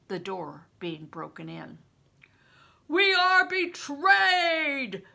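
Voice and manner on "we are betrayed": slow, booming high cry; thundered. Acted. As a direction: thunder it to the whole room. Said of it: "John Ball thundered".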